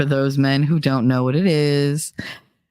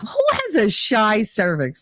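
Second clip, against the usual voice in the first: higher, slow, incredulous mock; mocking disbelief; 5 semitones higher than usual. Mocking the doctor's diagnosis, slow incredulous sneer. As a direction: drag it out in disbelief.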